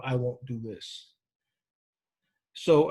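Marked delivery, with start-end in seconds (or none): 0.0-1.1 slow, quiet, firm refusal. Acted. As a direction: draw a line, slowly and softly. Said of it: "That's different from".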